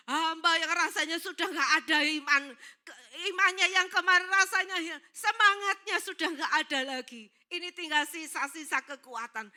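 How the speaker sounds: loud, high acted prayer; impassioned plea. Drained servant tells God his faith is gone, pleading loudly.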